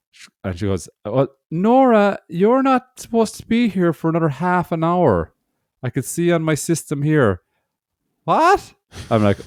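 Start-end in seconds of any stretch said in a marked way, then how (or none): 1.0-7.4 high clerk voice. Retold or imitated. 8.2-8.7 put-on high, startled old woman; old lady voice. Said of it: "I'm gonna do an old lady voice".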